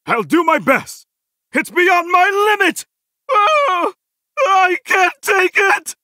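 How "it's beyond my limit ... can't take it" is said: high, agonised outcry; overwhelmed cry. Acted. Game hero being beaten, high desperate cries. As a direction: wail it out, pushed past the limit.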